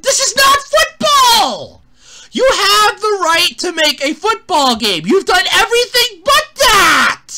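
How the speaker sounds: high, loud, furious outburst; angry rant. Real, not acted. Ranting at game developers about football, a furious shout. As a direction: yell it out at the developers.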